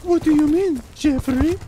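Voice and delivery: very high, hammed-up girly line reading; overacted girl's part. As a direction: overact the girl's lines in falsetto. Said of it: "they're reading a girl's part".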